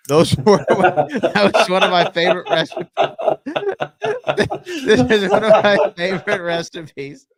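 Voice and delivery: high, loud words through laughter; cracking up. Real, not acted. Host loses it while reading a listener's letter, cracking up. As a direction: try to read on while laughing hard.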